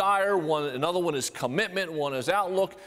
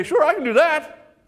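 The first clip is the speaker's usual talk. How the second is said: eager agreement; 9 semitones higher, 5 dB louder than usual. Retold or imitated. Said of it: "Brad's gonna say".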